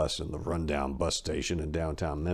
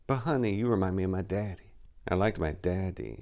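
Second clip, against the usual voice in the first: lascivious; 6 dB quieter than usual. Acted. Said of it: "she said with lascivious interest".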